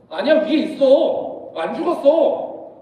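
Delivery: acted stubborn correction; insistent denial. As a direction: stretch each phrase to contradict. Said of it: "라고 말하면 뭐라고 말해요".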